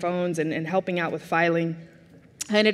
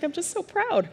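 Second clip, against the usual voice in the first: high, squeaky choked-up pride; mock tearful; 10 semitones higher, 4 dB quieter than usual. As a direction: imitate a mom welling up with pride. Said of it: "Are you crying?".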